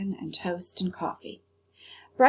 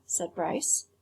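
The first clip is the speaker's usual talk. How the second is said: ordinary delivery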